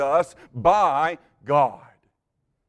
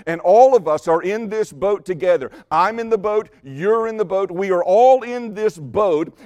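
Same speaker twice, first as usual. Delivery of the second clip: louder, rising preacher's call; fiery preaching; 3 semitones higher, 5 dB louder than usual. Acted. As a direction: preach it out to the whole congregation. Preaching the sinking sin boat.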